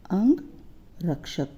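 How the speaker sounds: अंगरक्षक drawn out slowly, syllable by syllable; slow careful reading. Shown as a demonstration. Teacher reads the word slowly for pupils to follow. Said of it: "अंग हो गया शरीर".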